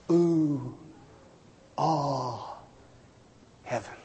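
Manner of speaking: very soft, low, spaced out; slow, understated mock wonder; hushed ooh and ah. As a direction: let each word drop away quietly. Mimicking half-hearted delay before heaven.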